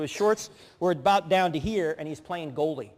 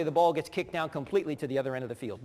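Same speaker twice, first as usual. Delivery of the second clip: normal talking voice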